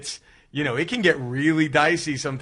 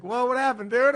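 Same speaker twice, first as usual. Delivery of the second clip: mock surprise; 9 semitones higher than usual. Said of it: "And I was like".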